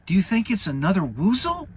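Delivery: squeaky worried Piglet. Acted. Narrator voices Piglet.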